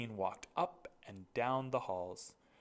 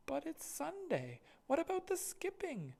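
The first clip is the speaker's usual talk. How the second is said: put-on much higher, childish whine; a small boy pleading; little brother.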